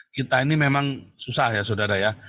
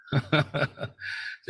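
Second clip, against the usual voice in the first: mocking chuckle; 2 semitones higher, 5 dB quieter than usual. Real, not acted. Preacher amused by his nickname for a rival, mocking chuckle. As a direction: chuckle at your own jibe.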